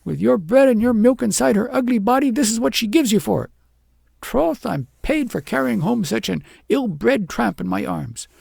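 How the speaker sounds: high, loud angry scolding; furious tirade. A narrator's reading. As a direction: rant at the ungrateful girl. Wife keeps raging at the girl.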